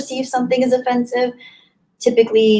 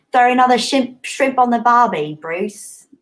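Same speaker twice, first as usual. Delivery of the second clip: put-on hammy, stumbling Aussie accent; unconvincing Aussie catchphrase accent; bad Australian accent; 3 semitones higher, 3 dB louder than usual. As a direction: have a clumsy go at sounding Australian. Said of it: "Was that Australian? No. Pretty bad".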